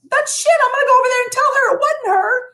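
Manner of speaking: a mother ready to storm over; indignant outburst. Retold or imitated. Angry mom defending her daughter.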